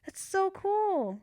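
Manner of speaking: long drawn-out, high gush; loud, thrilled squeal; excited. Real, not acted. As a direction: stretch it out in delight. Thrilled someone else has it.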